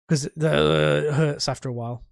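normal talking voice

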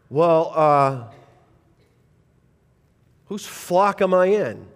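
ordinary delivery

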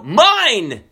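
put-on high, loud toddler's claim; possessive toddler. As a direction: act out a grabby toddler. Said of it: "But what comes next?".

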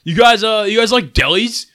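put-on drunk stranger. Drunk Sauce King calling out to the group, loud and high. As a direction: ham up a shit-faced bar guy offering a pitch. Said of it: "He's like, / He's shit-faced".

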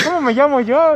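acted blunder. Friend fumbling his question to a crush, acted high and loud.

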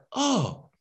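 retold little gasp of realization; imitated surprise. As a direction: act out her being taken aback. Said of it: "it cracks me up when people get surprised".

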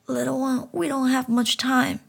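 put-on talking frog greeting the frightened child; gentle frog voice. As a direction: coax the boy tenderly. Frog Solomon warns boy.

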